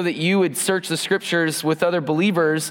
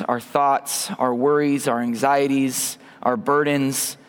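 prayer; 4 semitones lower than usual. Acted. Leads the congregation in prayer.

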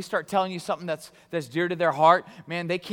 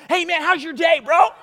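high, loud, hyped-up hello; passionate greeting; 11 semitones higher, 11 dB louder than usual. Shown as a demonstration. Passionate person greeting a friend, wildly excited. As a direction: blast the greeting out like a superfan. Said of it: "they don't talk to you like this".

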